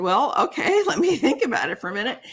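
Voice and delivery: mock-casual answer through giggles; retelling her own bemused reply; laughing retort. Retold reply to spirits.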